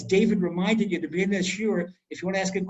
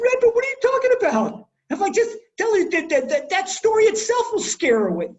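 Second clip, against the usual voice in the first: much higher, louder and fast, pleading in alarm; alarmed protest; 13 semitones higher, 7 dB louder, faster than usual. Retold or imitated. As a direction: blurt it out in a high, panicked rush to the rabbi. Young man protesting to the rabbi.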